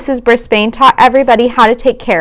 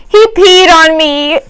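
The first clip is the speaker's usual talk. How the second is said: higher, slow shocked shriek; shriek of disgust; 8 semitones higher, slower than usual. Acted. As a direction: shriek it, horrified at the frog. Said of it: "Mandy shrieked".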